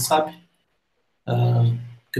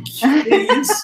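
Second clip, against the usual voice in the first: much higher, louder stunned question; astonished; 12 semitones higher, 4 dB louder than usual. Real, not acted. Host stunned by the Kombi trip from Argentina, disbelieving. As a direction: react in disbelief.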